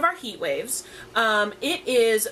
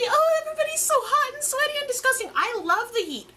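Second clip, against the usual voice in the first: much higher, gushing fake-cheerful voice; mock cheery; 14 semitones higher than usual. Mocking people who claim to love the heat, in a squeaky fake-cheery voice. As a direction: parody a chirpy heat-lover gushing over sweat. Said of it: "those people that try to be all cheery".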